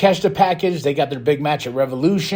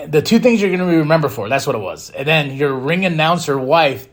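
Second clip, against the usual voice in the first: ordinary talk, nothing marked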